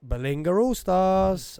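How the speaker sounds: high, slow booming introduction; announcer voice. Shown as a demonstration. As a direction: stretch the name like a ring MC. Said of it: "when I'm like".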